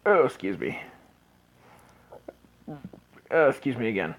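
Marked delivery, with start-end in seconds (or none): none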